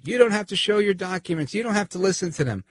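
hammed-up street yeller; mock yelling. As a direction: play the guy shouting advice at the cops. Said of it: "And he's yelling at them".

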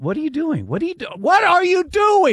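high-pitched incredulous demand; building from muttered disbelief to a high cry; exasperated fan yelling. Retold or imitated. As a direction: plead at the player in disbelief. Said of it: "I kept yelling".

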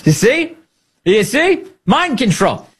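mock triumph. Real, not acted. Gloating over his own joke.

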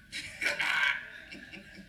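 breathy laugh trailing off; amused laugh. Real, not acted. Preacher tickled by repeating the lightning voice, amused laugh. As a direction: laugh at your own silly voice.

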